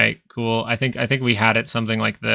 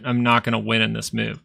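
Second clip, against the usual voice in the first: ordinary delivery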